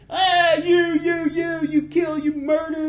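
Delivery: high-pitched, strained mock yell; mock ranting. Man on the hill cursing King David, mock high ranting. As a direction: wail out accusations like a heckler on a hillside. Acting Shimei hurling curses from hill.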